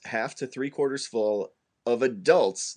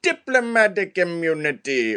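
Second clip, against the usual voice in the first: higher mock villain declaration; movie villain line; 6 semitones higher than usual. Podcast host baits co-host with a film villain's line, mock villain. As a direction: toss it out like a smug movie bad guy. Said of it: "let's say I said to you".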